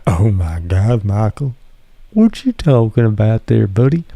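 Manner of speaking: hammed-up clueless viewer voice; mocking fan. Streamer mocking a fan who never cared, in a put-on voice. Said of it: "you were too busy going".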